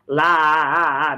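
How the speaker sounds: "La, a" with the A repeated and dragged on; exaggerated vowel clash. Shown as a demonstration. Teacher exposing the ugly sound, repeated clashing A after "La". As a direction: stutter out the A after "La" to show the clash. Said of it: "vai ficar feio o som".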